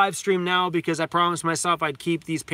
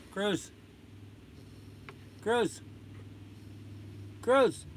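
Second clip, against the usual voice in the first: calling the dog; 5 semitones higher, 6 dB quieter than usual. Real, not acted.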